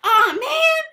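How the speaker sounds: high, loud cry of dismay; loud disbelief. Real, not acted. Exclaims at the clip in disbelief.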